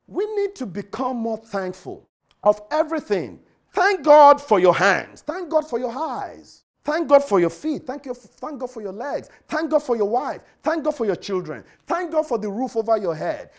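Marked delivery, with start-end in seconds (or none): 3.7-13.5 preaching. Acted. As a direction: lean into each thank God like a preacher. Preacher's litany of thanks.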